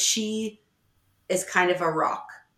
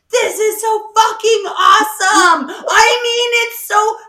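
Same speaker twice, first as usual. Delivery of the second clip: mock excited shout; 13 semitones higher, 9 dB louder than usual. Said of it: "it's always just like".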